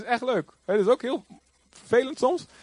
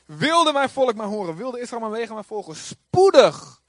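raised pitch, bursting louder on "Spoedig"; declaimed scripture reading; 4 semitones higher, 6 dB louder than usual. Acted. Preacher reading God's psalm to congregation, declaimed. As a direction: proclaim it, building to a punched last word. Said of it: "Ik lees hem al even voor".

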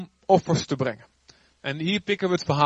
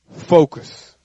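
louder, stern divine order; firm command; 11 dB louder than usual. Retold or imitated. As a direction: set each word down firmly. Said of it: "En God zegt". (God die streng en luid beveelt)

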